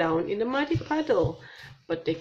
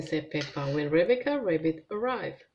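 ordinary delivery

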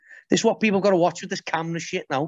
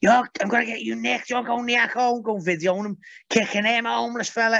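imitating shrill bystanders scolding the man; outraged women; 6 semitones higher than usual. Women yelling at the man who kicked the beggar, high mock outrage. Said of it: "these women were going".

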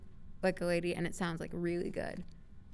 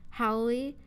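usual speaking voice

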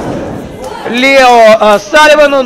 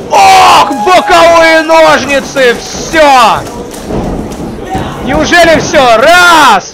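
excited commentator; 5 semitones higher, slower than usual. Real, not acted. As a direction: build up to the pin count. Live call of a near pinfall.

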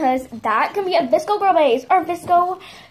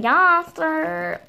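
ordinary delivery

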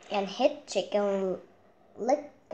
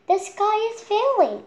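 high, loud frantic cry; panicked cry; 13 semitones higher, 8 dB louder than usual. Acted. Chick crying the news to Henny Penny, panicked. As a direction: cry it out in alarm. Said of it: "cried Chicken Licken".

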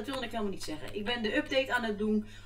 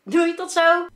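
excited; 8 semitones higher, 11 dB louder, slower than usual. Real, not acted. Bright goodbye before the clip.

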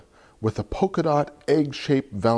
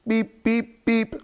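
truck beeps; 14 semitones higher than usual. Acted. Truck honks in picture book.

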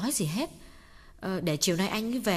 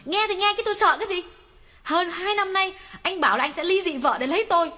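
much higher, louder jealous tirade; furious shout; 9 semitones higher, 6 dB louder than usual. Acted. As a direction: scream the betrayal at him. Jealous mistress shouting at lover. (la hét ghen tuông)

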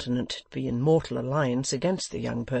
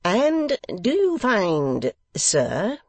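much higher, louder, very slow pompous voice; grandly, with great dignity; pompous host questioning his guest; 7 semitones higher, 6 dB louder, much slower than usual. A narrator's reading. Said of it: "with dignity".

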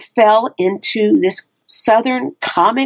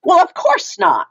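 high, twangy Texas mama voice, pitched well up; Mama Cherie's Texas accent; 9 semitones higher than usual. Acted. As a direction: play a sassy Texas mama who's unimpressed. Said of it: "the accent that you gave her".

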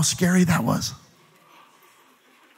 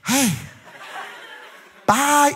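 lightly voiced check, pitched up for bye; a playful goodbye from a leaving check; mock check waving bye; 8 semitones higher, 3 dB quieter than usual. Preacher gives the departing check a voice, mock farewell. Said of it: "that check goes".

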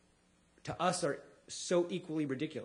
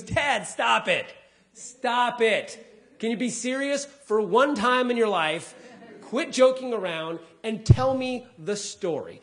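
put-on higher, louder, slow whiny kid voice; imitating his daughter; 6 semitones higher, 8 dB louder, slower than usual. Daughter in the back seat scolding her dad, slow and whiny. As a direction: drag it out like an exasperated little girl. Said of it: "She goes".

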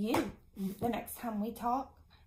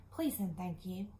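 normal talking voice

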